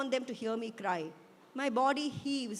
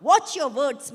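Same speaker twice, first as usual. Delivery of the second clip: loud, higher scolding shout; angry yell; 4 semitones higher, 12 dB louder, slower than usual. A narrator's reading. Mother yelling at her daughter for talking back, loud. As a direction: scold at full voice. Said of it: "my mother yells at me".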